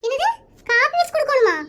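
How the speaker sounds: high outraged question; stunned disbelief. Acted. Interviewer shocked that the husband must serve coffee, high and outraged. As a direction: balk at the idea.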